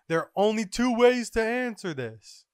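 high, loud declaration; scientist's proclamation. Acted. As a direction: proclaim it like a grand announcement. Acted announcement by Vegapunk.

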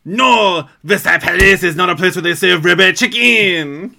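very loud, high mock-French protest; outraged French accent. Grandpa defends Versailles Palace, outraged mock French shout. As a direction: bellow it in a hammed-up French accent. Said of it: "And then he's like".

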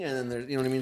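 ordinary delivery

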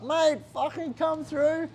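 high, drawn-out excited greeting; pumped-up doctor. Retold or imitated. UFC doctor greeting injured fighter at hospital, excited. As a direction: imitate a doctor thrilled to see his fighter. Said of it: "excited to fucking see me".

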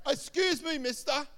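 high, loud mock-earnest question; mocking shopkeeper. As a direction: call across the shop to tease. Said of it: "he's a real mocking kind of guy".

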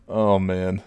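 normal talking voice

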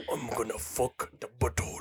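low, quiet caveman voice; jokey caveman muttering his plan. Acted. Caveman friend voiced in story.

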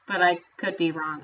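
ordinary talk

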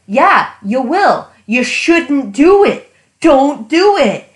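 loud, slow warning to the characters; yelling at the book. Retold or imitated. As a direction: plead with them loudly not to do it. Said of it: "I was like".

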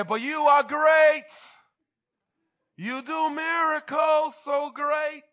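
high, drawn-out praise shout; praise accent. Acted. Preacher acting out praise under pressure, high slow shout. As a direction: belt out mock praise, slow and very loud. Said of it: "do you have a praise accent".

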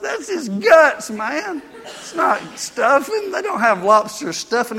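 high, slow cry of disgust at his meal; telling the brother at the beach what he's really eating, in shocked disgust; disgusted disbelief. Retold or imitated. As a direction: stretch it out, horrified he's eating guts. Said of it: "I said".

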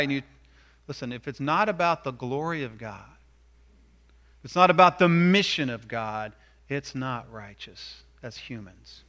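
usual speaking voice

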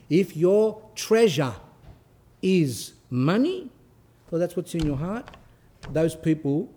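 slow, emphatic preaching voice. Acted. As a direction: drive the moral home slowly. Preaching the treasure saying.

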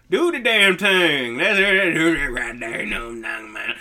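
loud, drawling stoner dude; rambling put-on surfer stoner voice. Shown as a demonstration. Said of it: "I was trying to do a stoner accent".

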